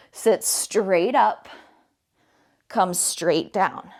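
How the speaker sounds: drawn-out slow coaching cue. Shown as a demonstration. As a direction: stretch each word out slowly. Coach cueing slow sit-ups.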